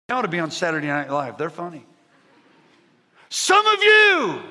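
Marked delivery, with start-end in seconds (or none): 3.3-4.4 high, very loud drawn-out yell; yelling preacher. Retold or imitated. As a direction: bellow it like a revival preacher. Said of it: "he's yelling".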